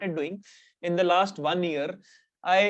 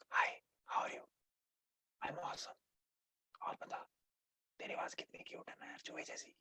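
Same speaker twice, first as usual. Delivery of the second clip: full whisper, much softer; ghost voice whisper; 19 dB quieter, much faster than usual. Shown as a demonstration. Playing both sides of a flirty call, whispered. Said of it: "talking in that ghost voice".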